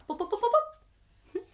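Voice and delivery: ordinary delivery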